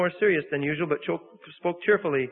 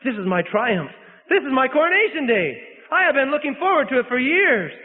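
exclaiming; 8 semitones higher, 4 dB louder than usual. A narrator's reading. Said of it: "he exclaimed".

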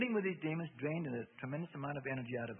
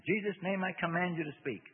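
raised, higher commanding voice; loud command; 6 semitones higher, 6 dB louder than usual. A narrator's reading. Bob commands the demon to speak, loud and firm. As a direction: order it forcefully to speak. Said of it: "spoke loudly".

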